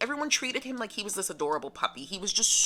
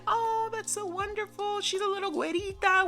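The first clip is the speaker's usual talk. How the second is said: very high gushing imitation of mom; super excited mom. Mother thrilled her son's girlfriend is American, very high gushing voice. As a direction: gush it way up high, delighted. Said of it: "she got super excited".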